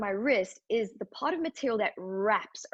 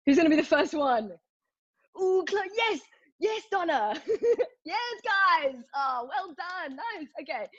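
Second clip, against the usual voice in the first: excited cheering; 7 semitones higher than usual. Real, not acted. Cheering on quiz answers in chat.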